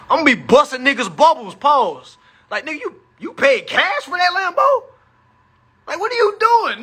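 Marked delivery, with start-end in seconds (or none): 2.5-6.8 incredulous shout. Acted. Mocking a guy who paid cash.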